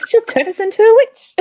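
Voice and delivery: hammed-up Python peasant; high mock villager. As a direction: shriek it like a panicked villager. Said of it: "quoting Holy Grail villager".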